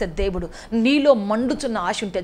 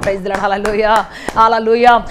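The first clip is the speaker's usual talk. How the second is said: shouted praise the Lord, hallelujah; loud hallelujah; 8 dB louder than usual. Real, not acted. Preacher rouses the congregation with a loud hallelujah. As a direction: lift the crowd with a loud hallelujah.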